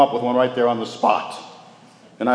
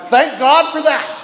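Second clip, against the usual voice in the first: much higher, louder, slow exclamation; a slowed, ringing cry of gratitude; joyful shout; 6 dB louder, slower than usual. Retold or imitated. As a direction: burst out in delighted thanks. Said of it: "And I shouted".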